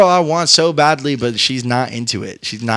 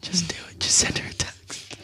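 secretive whisper to the guy; 16 dB quieter than usual. Pretend. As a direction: hush it like a conspirator. Whispering advice to listener.

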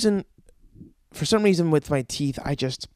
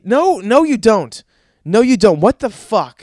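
loud, higher, acted disbelief; upset dentist; 4 semitones higher, 7 dB louder than usual. Dentist rejecting the patient's flossing claim, mock-upset outburst. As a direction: shoot the patient's claim down loudly. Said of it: "And they get upset".

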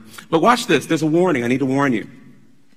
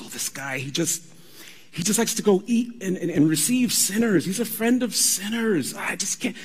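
exasperated, scandalised gossip; mock Pharisee whine; 6 semitones higher than usual. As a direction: roll your eyes at Jesus. Mocking the Pharisees' complaint.

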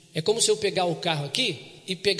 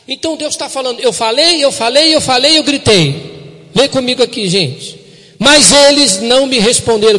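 loud, raised and driving; full-force preaching; 6 semitones higher, 14 dB louder than usual. Acted. Pastor preaches God's words to the church, loud. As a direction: preach it out to the room.

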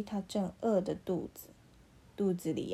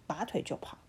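ordinary delivery, nothing marked

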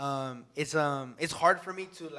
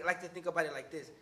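usual speaking voice

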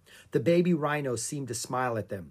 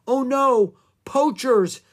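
high, loud cry of dread; alarmed cry; 11 semitones higher, 9 dB louder, slower than usual. A narrator's reading. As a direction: call out in alarm. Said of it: "cried Annie".

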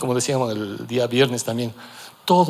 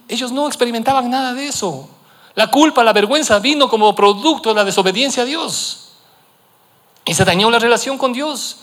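raised preaching voice; impassioned preaching; 9 semitones higher, 4 dB louder than usual. Acted. Pastor preaching about Adam and Eve, raised and driving. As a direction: press the point home.

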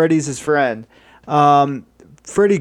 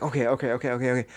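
low-key, softer muttered repeats; trying to calm down; 3 semitones lower, 9 dB quieter, much faster than usual. Acted. Killer collecting himself after the death, muttering. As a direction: mutter it softly to steady yourself.

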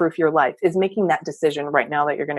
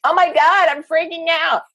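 panicked freak-out; 9 semitones higher than usual. Acted. Coach voicing a listener's gut feeling, freaking out. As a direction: voice the gut's alarm in a high, strained rush. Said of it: "you're having a little panic freak".

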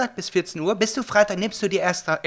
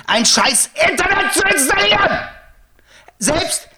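raised, heated complaining; angry rant; 8 semitones higher than usual. Real, not acted. Rant about Berlin internet. (wütend schimpfen)

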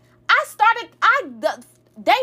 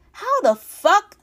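retold outrage; 5 semitones higher, 3 dB louder, slower than usual. Outraged at dad's accusation.

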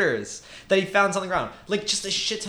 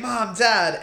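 mild mock scream; 5 semitones higher than usual. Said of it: "Tim is screaming".

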